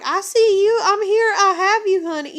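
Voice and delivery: loud, high-pitched retold outburst; frantic reassurance. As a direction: imitate a mother urgently calling to her child. Retells frantic reassurance to daughter.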